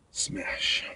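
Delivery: breathless whisper of smash. Acted. Bouncer repeats the punch word as a soft whisper. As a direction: hush it like a secret.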